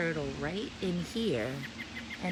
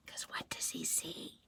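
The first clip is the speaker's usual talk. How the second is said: suspenseful whisper; hushed question; 12 dB quieter than usual. Acted. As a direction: hush before the reveal. Hushed aside to children.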